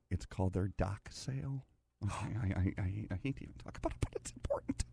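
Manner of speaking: hushed, secretive aside; stage whisper. Acted. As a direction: lean in and half-whisper it. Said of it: "That's a stage whisper right there".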